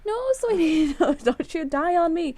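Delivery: high, loud laughing wail; anguished cry. Real, not acted. Begging Yono not to die.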